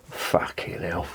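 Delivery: whispered, slow exasperated curse; under his breath. Real, not acted.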